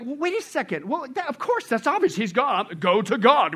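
high-pitched protest of the obvious; acted objector. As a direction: play someone objecting that it's obvious. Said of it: "you go like".

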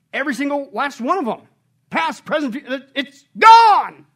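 loud, high preaching, building to a peak; full-force preaching. Acted. Pastor urging the congregation to confess, preaching hard. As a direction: preach it out to the whole room.

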